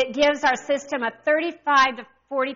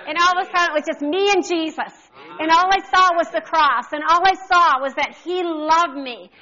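raised, higher and louder testimony; fervent preaching; 4 semitones higher, 4 dB louder than usual. Acted. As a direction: preach the climax with fire. Testimony rising into full preaching.

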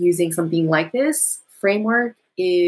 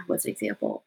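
muttered to herself, much softer and lower; quiet aside; 9 semitones lower, 10 dB quieter, faster than usual. Real, not acted. Speaker searching for an example, quiet aside to herself. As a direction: drop the voice, asking yourself. Thinking aloud mid-explanation.

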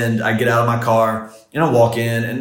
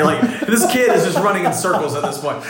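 laughing; 8 semitones higher than usual. Real, not acted. Laughs while retelling the salesman's scramble.

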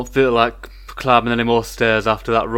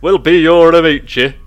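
loud mock Italian amici; imitating proper Italian; 5 semitones higher, 9 dB louder than usual. Friend mocks the player's amici, loud mock Italian. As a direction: repeat the friend's amici with exaggerated Italian flair. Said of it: "with the most, like, proper".

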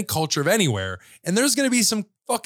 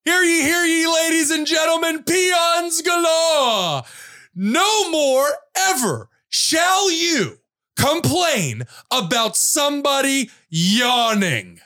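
town crier; 5 semitones higher, slower than usual. Acted. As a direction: proclaim a decree to the peasants. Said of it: "a segment called Hear Ye".

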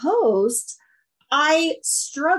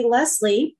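usual speaking voice

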